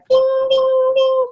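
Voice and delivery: high sung ding ding like a warning bell; mock alarm chime.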